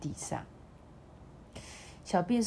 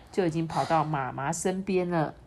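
ordinary delivery, nothing marked

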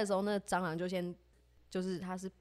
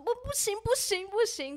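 much higher, rapid frantic no; imitating panicked refusal; 14 semitones higher, 3 dB louder than usual. Friend refusing to move the chair, high panicked no.

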